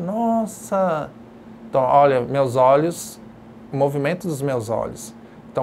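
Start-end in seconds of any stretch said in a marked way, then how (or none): 0.0-1.1 drawn-out, raised gasp of amazement; awed looking down. Shown as a demonstration. Teacher acting out looking down from a window, awed. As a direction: marvel at the drop below. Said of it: "olho lá pra baixo".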